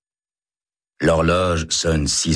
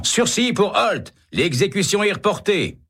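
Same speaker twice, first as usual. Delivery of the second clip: shouting the reprieve. A narrator's reading. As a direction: burst in shouting the news. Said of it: "arrivé en criant". (crié très haut, lentement)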